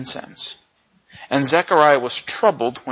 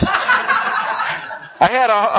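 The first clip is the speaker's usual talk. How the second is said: ordinary talk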